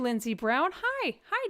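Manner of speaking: ordinary talk, nothing marked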